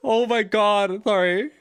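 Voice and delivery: slow high laughing apology; after laughing hard. Real, not acted. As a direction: still breathless with laughter. Recovering from laughter.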